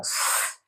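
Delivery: स as a long pure hiss 'sss', no vowel; letter sound. Shown as a demonstration. Teacher shows pupils the sound of S, a held hiss. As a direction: sustain स as a bare s sound. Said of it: "तो ये होगा".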